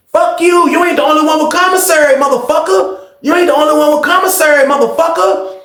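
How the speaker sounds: high-pitched defiant retort; angry comeback. Retold or imitated. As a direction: fire back, insulted. Retold angry comeback in argument.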